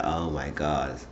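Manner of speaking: low, soft exasperated aside; retold dismay. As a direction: sigh it out under your voice, mildly appalled. Storyteller's own dismayed reaction.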